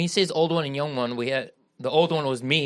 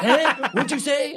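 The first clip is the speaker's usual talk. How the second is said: high, raised "what was that" challenge; dad demanding an answer; 11 semitones higher, 4 dB louder, slower than usual. Retold or imitated. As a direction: imitate a yelling father who didn't catch it. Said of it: "That's like my dad in my life".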